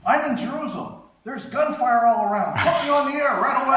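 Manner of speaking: high, loud, urgent mock report; [name] excited. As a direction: imitate a frantic caller demanding airtime. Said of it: "[name] is real excited".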